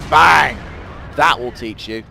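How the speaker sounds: loud gleeful bang; triumphant shout. Real, not acted. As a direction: gloat as the shell lands. Firing at enemy tank.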